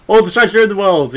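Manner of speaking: loud, fast, high cheer; excited cheer. Real, not acted. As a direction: shout it out with glee. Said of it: "Excitement jump".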